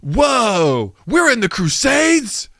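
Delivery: high, drawn-out bro voice shouting. Pretend. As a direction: warn a rival off like a frat bro.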